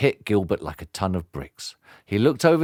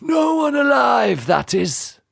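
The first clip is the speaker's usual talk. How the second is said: called out; slower than usual. Acted. Henchman shouting a threat after the departing pair. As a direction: fling the threat after them. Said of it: "the henchman called after them".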